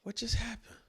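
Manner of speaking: whispered, high and breathless; stunned whisper. Retold or imitated. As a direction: hiss it in disbelief. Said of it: "I'm like".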